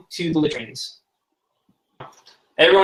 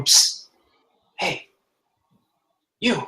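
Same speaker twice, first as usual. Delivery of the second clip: stage whisper; 3 semitones higher than usual. Acted. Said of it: "a whisper, someone going".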